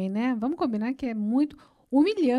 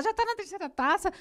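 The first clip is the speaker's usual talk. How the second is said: higher-pitched mock nagging; tactless nagging; 7 semitones higher than usual. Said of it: "é muito mais fácil você falar".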